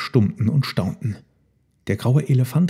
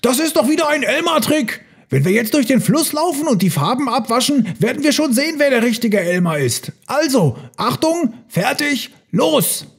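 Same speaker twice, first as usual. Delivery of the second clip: high, excited elephant call; rally the herd in a high voice; 14 semitones higher than usual. A narrator's reading. One elephant rallies the herd to the river, calling out high. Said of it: "Da rief plötzlich ein Elefant".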